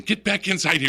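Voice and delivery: high, loud mock scolding; acted-out alarmed mom shout; imitated mother yelling. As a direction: holler like a mother ordering kids in. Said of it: "and a mother saying".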